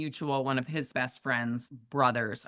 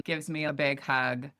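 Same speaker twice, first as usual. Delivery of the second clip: normal talking voice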